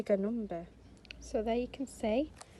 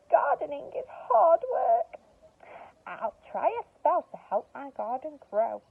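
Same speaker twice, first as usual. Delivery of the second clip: louder, higher complaining witch voice; exasperated witch; 5 semitones higher, 7 dB louder than usual. Acted. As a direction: groan it out as tired Winnie. Winnie voiced in story.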